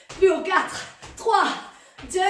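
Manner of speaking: loud, high coach countdown; shouted countdown. Real, not acted. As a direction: push them through the last reps. Coach counting down last reps.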